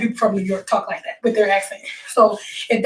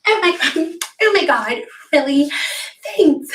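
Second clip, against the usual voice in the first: higher, put-on gushing reaction; mock gushing; 5 semitones higher than usual. If somebody don't be like this they're mad, mock gushing. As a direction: gush it in a raised, imitating voice.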